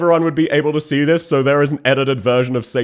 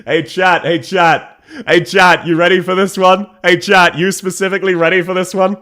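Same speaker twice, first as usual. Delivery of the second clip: loud, teasing sing-song to chat; teasing call-out; 5 semitones higher, 5 dB louder than usual. Real, not acted.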